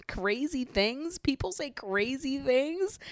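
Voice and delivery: slow, high, rising questions; incredulous echo. Acted. Recapper throwing Olivia's excuse back at her, incredulous. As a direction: draw it out in scandalised disbelief.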